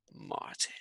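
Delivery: breathy dramatic whisper; whispered name. A narrator's reading. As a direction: hush it like a scary secret. Dramatic naming of the dragon.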